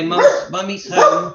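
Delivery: high, loud sing-song calling to the dog; excited pet talk. Real, not acted. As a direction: greet the excited dog at the door. Greeting barking dog at homecoming.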